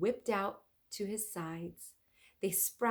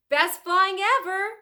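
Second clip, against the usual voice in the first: loud, much higher joyful shout; 12 semitones higher, 12 dB louder than usual. Acted. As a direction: shout it to the sky. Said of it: "shouted Jack".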